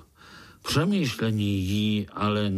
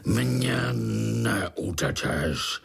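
mild mock roar; much slower than usual. A narrator's reading. Fly chases the escaping spider, mildly roared threat. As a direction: draw out the threat, sinking in pitch. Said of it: "zařvala".

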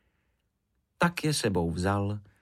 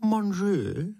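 high-pitched French question; storybook French, lifted high; 10 semitones higher than usual. A narrator's reading. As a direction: put on a French accent, pitched well up. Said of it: "K tomu po francouzsku".

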